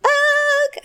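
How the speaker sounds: high squeaky ugh; Ugg grunt. Pretend. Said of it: "Hey, that was not bad".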